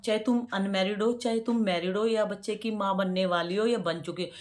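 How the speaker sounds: usual speaking voice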